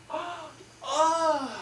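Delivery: put-on high, loud shocked old ladies' cry; shocked gasp. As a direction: gasp out in scandalised surprise. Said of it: "their mouth went".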